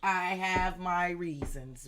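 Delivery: loud, drawn-out words at normal pitch; slow, deliberate restatement. Real, not acted. Reader restates the excuse for not accepting help, slow and deliberate. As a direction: stretch each word out deliberately. Repeats the defence deliberately after outburst.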